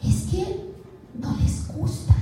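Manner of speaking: dropped to a near whisper; a quiet, breathy aside; hushed. Real, not acted. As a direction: let the point fall softly. Lecturer stresses key point.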